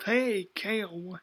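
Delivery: slow, whispered and smug; smug menacing whisper. Retold or imitated. Abusive mother whispering a threat to her daughter, smug and menacing. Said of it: "in this really menacing, in this smug voice".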